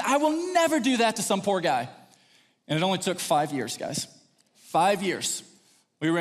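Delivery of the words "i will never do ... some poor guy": high, insistent declaration; indignant vow. Retold or imitated. Young man judged by girls' dads, indignant vow. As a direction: swear it with youthful indignation.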